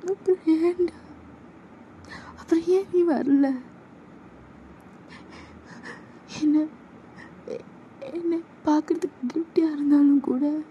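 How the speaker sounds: high voiced, reproachful; demanding why he never came; hurt young woman.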